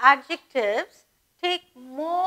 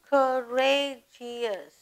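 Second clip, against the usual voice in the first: teacher stretching a word for the class; slow, drawn out; much slower than usual. Shown as a demonstration. Counting syllables of words aloud.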